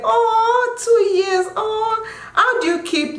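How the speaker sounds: high, drawn-out "aww"; touched, high-pitched gushing; cooing. Real, not acted. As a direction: coo over the newlyweds. Cooing over newlywed viewer's question.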